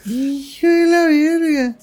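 slow high drawn curse; slow mock exclamation. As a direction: drag the curse out.

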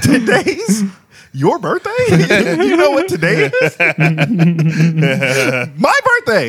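high, laughing mock reveal; breaking up while quoting her; real laughter, mock dancer; laughing retelling. Real, not acted.

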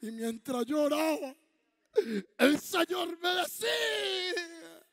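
mock ecstatic preacher. Parodying pastor's ecstatic preaching.

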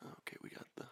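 hushed unvoiced mutter; whisper. Real, not acted. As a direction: whisper it off-mic. Whispering while fixing earbud.

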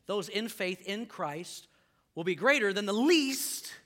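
ordinary delivery

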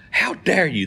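high indignant gasp of a line; hammed-up shock; mock outrage. Offended couple voiced in joke.

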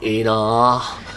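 low, drawn-out envious murmur; うらやましがる声. Retold or imitated. Co-host mimicking their writer envying the radio show, wistful and drawn-out. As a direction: imitate a wistful onlooker, stretching the vowels. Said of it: "って言ってなんか".

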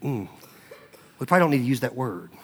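soft, hesitant, gentle correction; softly acted loving hesitation. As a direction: ease into a tender, tactful reproof. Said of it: "very lovingly be like".

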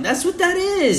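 high, loud mock scold. Friend mocks cohost's baby lung, in a high loud mock voice. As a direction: tease him in a put-on voice. Teasing continues, put-on voice.

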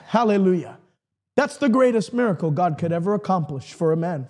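usual speaking voice

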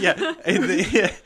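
high laughing restart. Real, not acted. Host laughing while trying to go on with story. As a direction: try to talk through laughter.

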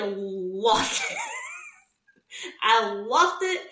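laughing through the words, voice going high. Real, not acted. Friend retelling her breakdown, laughing. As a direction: crack up as you admit it.

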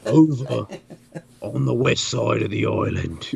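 talks like an Aussie pig; lightly voiced Australian pig; Australian accent. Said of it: "it has an Australian accent".

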